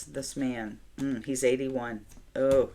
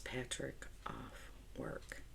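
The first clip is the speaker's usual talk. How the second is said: much softer, breathless whisper; shocked whisper; 16 dB quieter, slower than usual. Retold or imitated. Reading her planner note to herself, stunned whisper. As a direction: whisper it as the mistake dawns.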